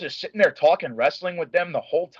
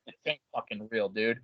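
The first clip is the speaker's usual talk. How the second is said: hushed disbelief; 5 semitones lower, 11 dB quieter than usual. Retold or imitated.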